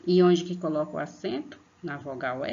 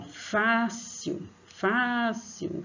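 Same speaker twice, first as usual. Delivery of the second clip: "fácil" called high and slow on "fá"; stress on first syllable; 6 semitones higher, much slower than usual. Shown as a demonstration. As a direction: stretch the "fá" of "fácil". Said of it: "o fá é a sílaba mais".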